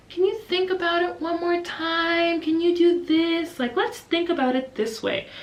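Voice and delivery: slow, high, gentle coaxing; lightly sing-song soothing teacher voice; calm teacher. Retold or imitated. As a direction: patiently nudge a small child to think it over. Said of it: "me and my co-teacher are just so calm".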